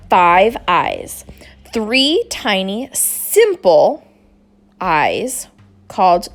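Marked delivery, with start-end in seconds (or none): none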